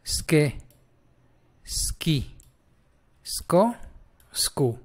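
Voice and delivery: ske, ski, sko, sku as separate syllables; sound drill. Shown as a demonstration. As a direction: chant out ske, ski, sko, sku one at a time, starting on a bare s. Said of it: "vamos a hacer ska".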